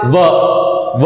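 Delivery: व said alone with lip on teeth; demonstrated व. Shown as a demonstration. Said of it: "अब वो बोले आप". (होंठ दांत से छूकर व का उच्चारण)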